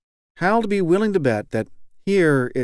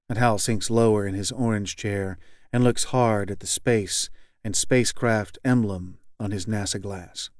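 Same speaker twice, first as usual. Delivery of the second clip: ordinary delivery, nothing marked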